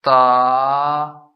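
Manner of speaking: second tone on ता; rising tone. Shown as a demonstration. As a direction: lift ता up steadily. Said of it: "इसलिए इसको पढ़ा जाएगा".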